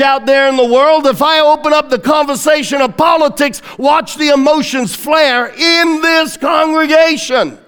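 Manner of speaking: fiery pulpit delivery, every line hammered home; preaching at full force. Acted. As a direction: preach it out to the back row. Preaching builds again.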